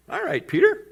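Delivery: high-pitched mock agreement; playful indulgence. Jesus answering his brash disciple, playfully high.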